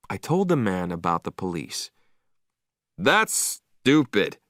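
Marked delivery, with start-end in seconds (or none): 3.0-4.4 shouting. A narrator's reading. Killer's owner hearing about the police, shouting. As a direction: yell it like it's nonsense. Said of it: "he shouted".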